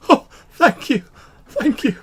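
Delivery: high, loud, quavering thanks on the edge of tears; a girl thanking the priest through tears, voice breaking. Acted. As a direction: sob out the thanks, voice cracking high. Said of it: "her voice quavers as though it's threatening to break".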